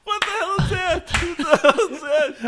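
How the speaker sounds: high-pitched laughing disbelief. Real, not acted. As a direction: squeal it through a laugh. Laughing at the clip.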